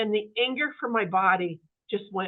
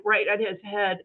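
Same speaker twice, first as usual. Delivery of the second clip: ordinary talk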